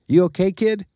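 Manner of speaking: a hint of a big dog's voice. A narrator's reading. Big dog asks pup.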